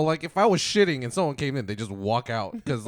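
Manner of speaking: ordinary talk, nothing marked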